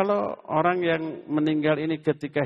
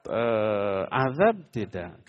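ordinary delivery

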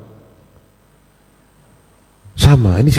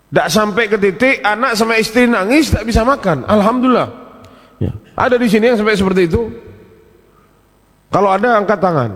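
raised, fast preaching to the congregation; 9 semitones higher than usual. Acted. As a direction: rouse the congregation from the pulpit. (khotbah bersemangat dengan nada tinggi)

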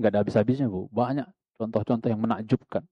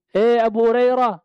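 high, loud call to her son; mother calling; 9 semitones higher, 5 dB louder than usual. Retold or imitated. Preacher voicing the mother calling her son, loud and high. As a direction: call him from the next room. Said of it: "Ibunya manggil".

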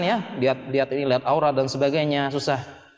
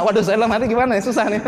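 higher, louder, quick fretting; mock inner panic; 5 semitones higher, 4 dB louder, faster than usual. Preacher voices friend fretting over shared crush, higher and louder. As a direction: fret aloud like a worried rival.

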